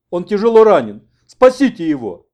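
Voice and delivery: loud, high-pitched cry for help; urgent plea. Acted. Interpreter begs rescue for a badly wounded man, urgent plea. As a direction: beg them to save him.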